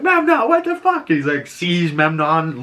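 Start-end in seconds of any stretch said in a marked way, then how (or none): 0.0-1.1 much higher, exasperated yell; pissed off. Retold or imitated. Achilles left alone fighting, yelling at Memnon angrily. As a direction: snap at him, fed up. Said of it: "and he's pissed".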